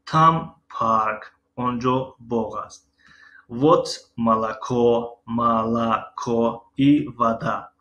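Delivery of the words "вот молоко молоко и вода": slow, deliberate Russian; slow teacher demo; slow model reading. Shown as a demonstration. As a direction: read each word slowly for students. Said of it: "شیر و آب".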